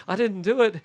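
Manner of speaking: exaggerated clueless act; mock simpleton.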